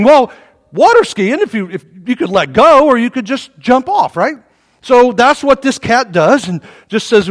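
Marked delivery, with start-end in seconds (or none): none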